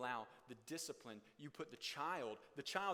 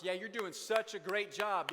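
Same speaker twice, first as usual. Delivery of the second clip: slow, loud mock compliment; sarcastic praise; 6 semitones higher, 10 dB louder, slower than usual. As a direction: stretch the fake praise out loud.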